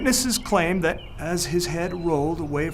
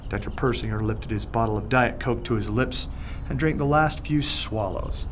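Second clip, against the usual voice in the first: ordinary delivery, nothing marked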